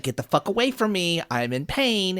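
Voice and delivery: ordinary talk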